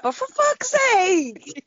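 high, drawn-out wail of exasperation; exasperated outburst. Retold or imitated. Fan reliving anger at the wrestling, exasperated cry. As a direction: stretch it out slowly, pitch soaring. Acting out exasperated shouting at screen.

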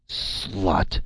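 a hint of a roaring prince; booming insult. A narrator's reading. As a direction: spit the insult like a brute. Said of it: "His booming voice filled the room".

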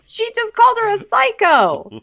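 squeaky high mimic; high mocking cast-member voice; mock high quote. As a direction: pitch it way up, mocking.

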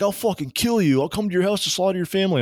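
fast, high-pitched mock rage; hammed-up frantic threat; retold angry threat. Scammer threatens caller, retold.

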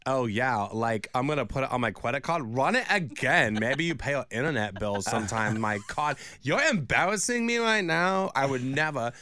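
a light, snobby put-on Anna Delvey accent; lightly voiced Anna Delvey, scolding the cashier. Host at the self-checkout mocks the cashier in a light Anna Delvey accent. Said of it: "And I was like".

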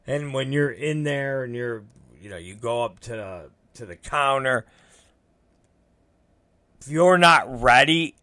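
ordinary talk

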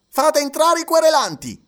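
high, loud courtroom command; judge's order. A narrator's reading. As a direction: summon them in with authority. (ordine del giudice, acuto e forte)

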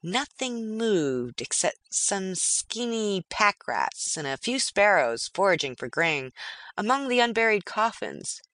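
ordinary delivery

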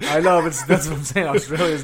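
amused, laughing reply. Real, not acted. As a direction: talk through a laugh. Laughing over his botched accent.

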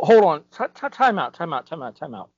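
ordinary talk

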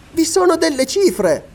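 very high-pitched cry of discovery; lady's high, excited outburst; excited exclamation. A narrator's reading. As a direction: exclaim it high, as if just spotting it. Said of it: "esclamò Elena".

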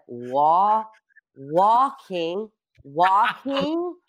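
syllables stretched with long pauses; slow talking. Retold or imitated. Game show host giving a clue, slow talking. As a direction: draw each word out, very slowly. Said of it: "You see me talking so slow".

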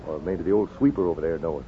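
ordinary talk

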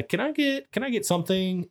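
high, meek little voice asking; sheepish plea. Retold or imitated. Naked man meekly asking the cleaning lady for something. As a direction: squeak it out like a naked man begging.

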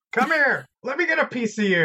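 high, loud acted challenge; hammed-up battle shout; fighting yell. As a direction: holler like you're spoiling for a fight. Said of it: "I'm gonna tear into 'em".

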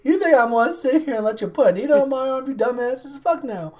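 indignant mock rant with a laugh; fast, indignant rant. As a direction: tear into them, laughing. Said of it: "own imagined retort".

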